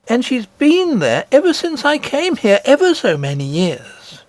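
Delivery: put-on an eager child telling a wonder; little princess. Princess telling nurse of her grandmother, high and eager.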